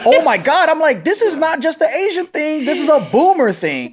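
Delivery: high-pitched exclaiming, recounting a revelation; astonished realisation. Retold or imitated. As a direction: act out the big realisation, voice leaping up. Acting out their own astonished realisation.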